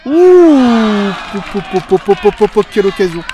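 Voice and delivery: loud burst of excitement at a chance; excited commentary shout. Real, not acted. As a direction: yell it out as the ball flies by. Near goal in live commentary.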